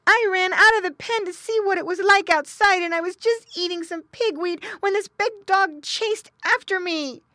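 high, loud piglet voice; upset little pig. Acted. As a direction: whine the story to mother in a piglet voice. Little pig figure explains.

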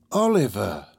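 ordinary delivery

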